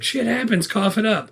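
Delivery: put-on kid's retort. Kid demands money from a guerrilla, high mock retort. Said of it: "the kid says the same thing".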